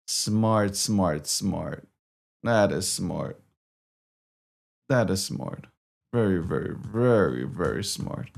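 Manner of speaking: low, soft, dry sarcasm; sarcastic muttering. Real, not acted. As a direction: mutter the praise you don't mean. Sarcastic self-praise after failing.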